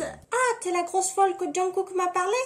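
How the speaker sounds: high put-on voice. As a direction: ask it in a silly high voice. Voicing [name]'s line for recording.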